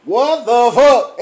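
drawn-out shocked what the fuck; slow, loud disbelief at her outfit. Retold or imitated. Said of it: "So I said".

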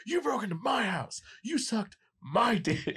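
high, slow mock Keanu yell; mock outraged Keanu, drawn out; Keanu's line. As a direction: ham up Keanu's indignant outburst. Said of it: "he goes like".

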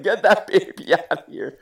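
high, loud alarmed command; urgent shout. Retold or imitated. Medic shouting to get the baby away from the blood, loud and urgent. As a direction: order the baby away at once.

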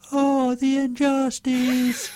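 high, slow, whiny put-on wail; pathetic cartoon chick. Whiny chick voice, when telling the host about Calimero. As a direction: squeak it out like a sorry little chick. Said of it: "said in a very pathetic voice".

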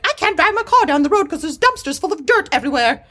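high-pitched, rushed mock British accent; hammed-up British accent of an irked neighbour; bad British accent. Neighbour pissed about dumpsters, in a bad British accent. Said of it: "that's the worst British accent I've ever heard".

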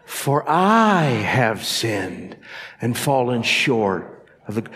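I stretched very slow; stress on I again. Shown as a demonstration. Repeating with the church, stress on I. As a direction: draw out I. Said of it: "say it with me again".

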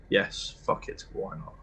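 breathy whisper to himself; a resigned whisper, fading to nothing; whispered aside. Real, not acted. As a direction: toss it off under your breath. Player's muttered aside to himself.

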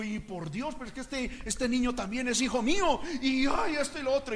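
soft, lightly acted complaint; imitated pained protest. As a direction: plead under your breath as an upset father. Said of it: "le pareció eso duro y dijo".

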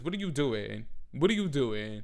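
usual speaking voice

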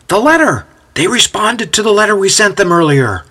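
high-pitched eager announcement; thrilled messenger; excited. A narrator's reading. Timothy bringing eagerly awaited news.

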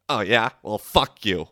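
mild mock belligerence, snapping back; retold hostile retort. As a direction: imitate her sudden hostility.

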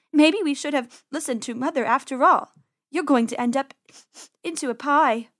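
lightly voiced fretting sister rabbit; worried rabbit sister. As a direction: speak up as a little rabbit.

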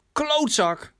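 hoge, woedende uitval van een dochter; geschreeuwd scheldwoord. Acted. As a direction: scheld je vader hoog en fel uit. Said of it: "schreeuwde zijn dochter". (woedend uitgeroepen)

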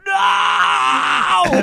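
high, drawn-out mock "no" wail; comic cry of disbelief; mock horrified yell. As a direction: yell it in hammed-up dismay. Retold horror at drifting houseboat.

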